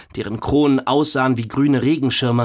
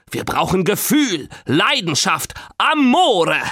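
passionate grandpa; 7 semitones higher than usual. A narrator's reading. Grandpa demands romantic music.

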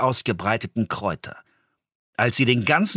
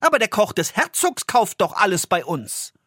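put-on higher boy's voice, fast and annoyed at first; indignant boy; 11 semitones higher than usual. Jakob telling off the old woman for squeezing the herbs. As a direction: scold her like a cheeky cross lad. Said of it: "rief er unmutig".

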